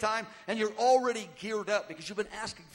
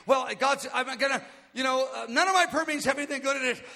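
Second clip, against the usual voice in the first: louder, higher, worked-up outburst; mock frenzy; 4 semitones higher, 6 dB louder than usual. Frantic prayer leader fretting over dead meetings, hyper and frenzied. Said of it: "become hyper and frenzied".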